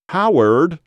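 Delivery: high, loud whiny call; whiny wife. A narrator's reading. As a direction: whine out the name to your husband from afar. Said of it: "a whiny voice said from down the trail".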